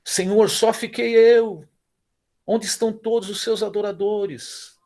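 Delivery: high, loud, slow lament; complaining Elijah. Acted. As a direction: cry out like a desperate prophet. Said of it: "Ainda que errado".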